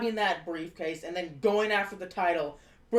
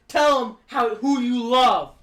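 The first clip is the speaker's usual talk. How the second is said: insistent; 4 semitones higher, 7 dB louder than usual. Retold or imitated. Solo shoves Jacob, insisting.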